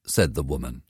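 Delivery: plain reading voice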